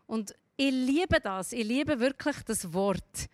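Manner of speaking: ordinary talk, nothing marked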